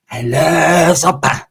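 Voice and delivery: slow and dreamy, savouring it; slow drawn-out inmate voice. Acted. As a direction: drag the words out lovingly. Pepe voiced slowly.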